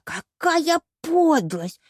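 high, very drawn-out cry of indignation; outraged. A narrator's reading. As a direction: stretch it out in melodramatic outrage. Penelope outraged after her squeal. (возмущённо, с надрывом)

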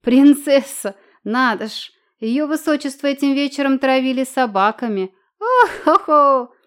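high, loud jeering guffaw; mocking laughter. Dikon mocking the ragged princess, roaring with laughter. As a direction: howl with scorn. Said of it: "хохотал".

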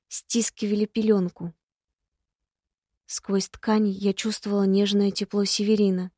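plain reading voice

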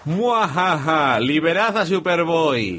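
slow, high cackling villain; mustache twirling villain. Retold or imitated. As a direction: ham it up like a cartoon villain. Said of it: "Y dice el malo".

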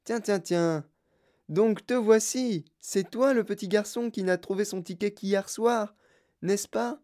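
high, eccentric host sizing up a guest; Wonka intrigued. Acted. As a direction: greet the last child with lifted-pitch interest.

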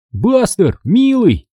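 high, eager call to the dog; crying out. A narrator's reading. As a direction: cry out pleadingly, pitched high. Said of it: "закричал я". (радостный крик собаке)

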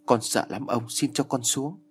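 ordinary delivery, nothing marked

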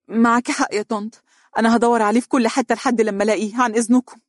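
put-on young woman, hastily excusing herself; Donia composing herself. A narrator's reading. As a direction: brush them off politely and hurry. Donia voiced, hurrying off.

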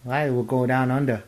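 badly faked Aussie accent; Australian accent. Shown as a demonstration. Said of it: "what's your Australian accent like?".

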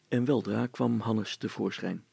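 ordinary delivery, nothing marked

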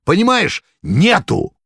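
high, loud frantic yell; desperate shout. A narrator's reading. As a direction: yell it in panic. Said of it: "страшным голосом прокричал".

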